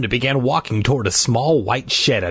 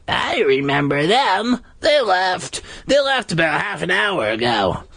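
creepy monster worker; 9 semitones higher than usual. Acted. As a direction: speak like a sly park monster. Costumed horror speaking.

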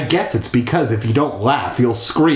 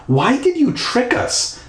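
put-on high girl voice; 12 semitones higher than usual. Voicing Stephanie's demand.

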